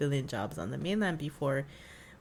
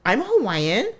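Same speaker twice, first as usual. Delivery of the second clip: put-on higher, louder indignant child; insistent kid; 8 semitones higher, 9 dB louder, slower than usual. Little girl insisting to her brother, high indignant voice. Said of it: "sister insisting at dinner".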